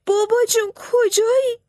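put-on boy faking tears for the enemy; fake crying wail. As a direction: wail like a lost child to fool the soldiers. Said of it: "بلند بلند ناله کرد و داد زد".

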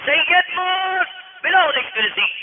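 loud, high cries for help; frantic shouting, acted out; frantic cry. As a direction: call out the alarm in drawn-out, high shouts. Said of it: "دیوانه وار از حجره دوید بیرون".

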